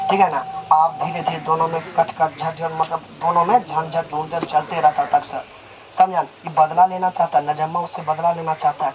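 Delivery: plain reading voice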